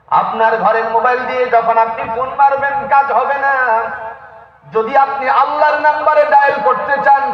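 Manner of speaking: high, driving sermon delivery; impassioned preaching. Acted. Preacher warning listeners about dialling Allah's number, impassioned preaching. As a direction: press the point home at high pitch.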